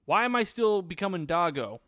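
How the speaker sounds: imitating an indignant protest; mock complaint. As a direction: whine it as a baffled complaint. Acts Prince's complaint.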